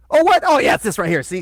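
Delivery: high, loud eager "oh, what?"; eager quote. Retold or imitated. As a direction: play the keen helper spotting the fault. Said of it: "I'm all like".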